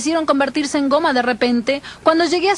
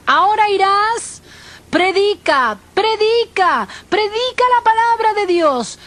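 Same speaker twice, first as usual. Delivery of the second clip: higher, insistent divine summons; Jesus commanding; 5 semitones higher, slower than usual. Retold or imitated. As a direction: repeat the order like a ringing call. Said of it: "Jesús hablaba a mi alma". (llamado insistente con voz elevada)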